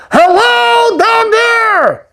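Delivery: calling out high to someone far below; high, ringing call down from a balloon; booming call. Acted. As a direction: hail the stranger on the ground from the sky. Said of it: "with the loud booming voice".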